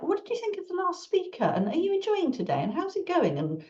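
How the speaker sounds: mock cheery conference chatter; chatty small talk. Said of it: "it's just like".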